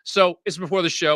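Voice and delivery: normal talking voice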